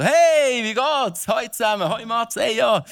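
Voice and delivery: overly cheery high greeting; theatrical, bright phone hello; hammy stage actor. Pretend. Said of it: "versuch das da mal ein bisschen theatralisch zu spielen". (übertrieben fröhliche Begrüssung)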